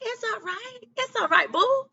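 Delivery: comforting guardian angel. Pretend. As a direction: voice a soothing angel hugging you. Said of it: "imagine them hugging you and telling you".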